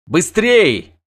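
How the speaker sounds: loud, high shout to hurry; urging shout. A narrator's reading. Wizard on a prancing horse hurries them, urging shout. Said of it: "крикнул Доригарай".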